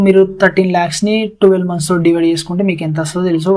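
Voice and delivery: usual speaking voice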